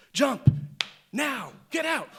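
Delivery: loud, high barked order; urgent command. Retold or imitated. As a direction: get them out of the car now. Mom orders kids out.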